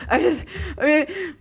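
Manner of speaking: high mock whimper; verge of tears. Fiance failing to roll a joint, mock tearful. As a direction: imitate someone about to cry, high and faltering. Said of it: "at the verge of, I'm assuming, tears".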